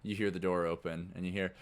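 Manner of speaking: usual speaking voice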